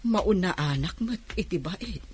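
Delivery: grouse quietly so no one hears; bitter muttering alone after the niece leaves; muttering to herself. Acted. Aunt grumbling alone after visit.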